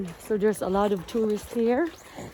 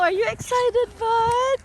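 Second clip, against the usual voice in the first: much higher, eager sing-song question to the dog; high-pitched dog talk; 10 semitones higher than usual. Real, not acted.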